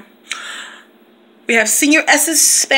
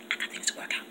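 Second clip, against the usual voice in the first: hushed, breathy whisper; 20 dB quieter, much faster than usual. Real, not acted. Teacher whispering to her followers about her mood. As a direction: whisper it like a secret.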